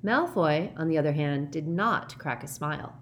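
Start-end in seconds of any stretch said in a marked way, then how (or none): none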